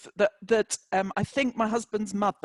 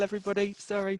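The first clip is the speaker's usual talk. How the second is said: ordinary delivery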